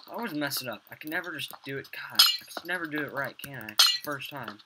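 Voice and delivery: frustrated outburst. Real, not acted. Frustrated at failing in game.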